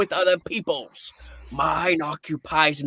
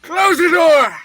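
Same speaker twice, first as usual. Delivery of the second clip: high loud shouted command; barked order; 11 semitones higher, 7 dB louder than usual. Acted. Scrooge ordering his clerk, loud barked command. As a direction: bark it at the clerk.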